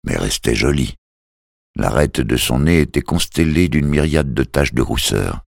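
ordinary delivery, nothing marked

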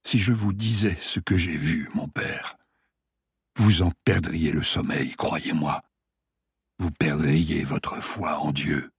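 lightly voiced dying patient, low and faint; a hint of a weak old patient; dying man voice.

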